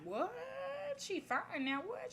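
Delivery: high-pitched incredulous what; hammed-up astonishment; astonished. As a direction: react in disbelief, voice jumping up. Acts own astonished reaction to story.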